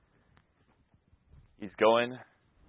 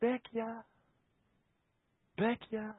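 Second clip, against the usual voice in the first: soft call; 9 semitones higher, 14 dB quieter, slower than usual. Retold or imitated. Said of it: "He's just saying".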